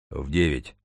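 low, restrained giant's voice; deep heavy bass. A narrator's reading. Mathematician clarifies the time after a pause, low bass. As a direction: drop the pitch for the big man. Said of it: "Такого глубокого и тяжёлого баса".

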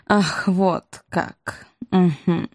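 very slow, measured reaction; ominous acknowledgement. Acted. Queen hears the enemy thrives, slowly ominous. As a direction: draw it out slowly, weighing the news. Queen receives unwelcome news.

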